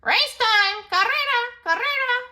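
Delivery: high, loud parrot calls; calling out. Acted. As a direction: announce it to the whole jungle. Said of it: "the parrots called out".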